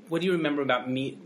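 ordinary talk

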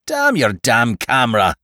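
exasperated, mild yell. A narrator's reading. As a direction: snap it at him in exasperation. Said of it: "yelled Marnigan".

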